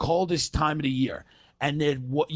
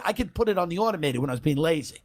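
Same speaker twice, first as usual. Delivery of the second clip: ordinary delivery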